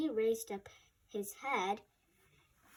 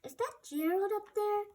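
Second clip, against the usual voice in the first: high astonished question; surprised; 9 semitones higher than usual. Acted. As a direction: ask in disbelief. Hippo amazed.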